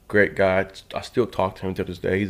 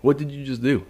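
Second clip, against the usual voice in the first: barking drill instructor; 6 semitones higher than usual. Retold or imitated. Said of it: "he's like".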